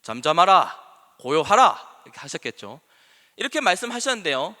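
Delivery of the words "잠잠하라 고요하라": loud, firm imitation of a commander; authoritative command. Preacher imagining Jesus ordering wind and sea, authoritative command. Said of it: "더 권위가 있었을 것 같아요".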